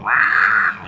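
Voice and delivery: loud sudden squawk; loud imitated parrot screech. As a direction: blurt a bird screech. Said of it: "a parrot going".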